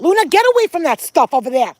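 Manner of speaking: loud, high yell to the dog; sharp command. Real, not acted. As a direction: yell an urgent order across at the dog. Calling the dog away.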